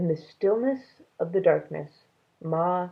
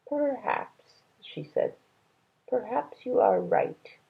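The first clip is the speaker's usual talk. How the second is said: small sad agreement; 6 semitones higher, slower than usual. A narrator's reading. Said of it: "gave a small, sad smile".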